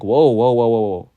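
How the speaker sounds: loud, urgent whoa to halt him; calling out to stop him. Acted. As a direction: stop the boy with loud repeated whoas. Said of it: "as they're like".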